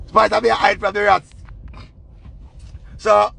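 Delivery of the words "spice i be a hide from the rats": ordinary talk, nothing marked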